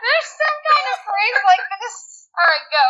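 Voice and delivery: high, squeaky real laughter; laughing hard. Real, not acted. Host searching for a phrase, helpless laughter. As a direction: squeeze the words out through laughter. Cracking up over mangled phrase.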